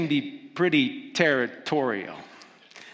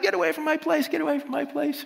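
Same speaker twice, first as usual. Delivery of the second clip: high, barking words; territorial dog voice; 14 semitones higher than usual. Retold or imitated. Preacher voicing a dog warning off a passerby, high yapping. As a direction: snap like a dog guarding its fence. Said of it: "it's like".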